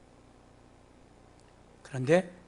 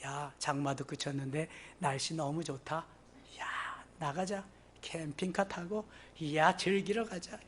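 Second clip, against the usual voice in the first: much softer, offhand imitation of holidaymakers; hushed mock chatter; 11 dB quieter than usual. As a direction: softly mimic people planning an outing. 놀러 가자는 사람들 말 흉내. (나직하게 흉내 내는 말투)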